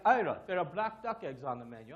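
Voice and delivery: loud mock Russian accent, dropping in pitch; lightly hammed-up Russian accent. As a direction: imitate a Russian defector, loudly. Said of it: "Stan is a Russian defector".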